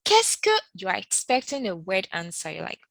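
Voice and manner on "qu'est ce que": "Qu'est-ce que" much higher and louder; teacher stresses "Qu'est-ce que"; emphasized Qu'est-ce que. Shown as a demonstration. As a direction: punch "Qu'est-ce que" high and loud. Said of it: "So when you say".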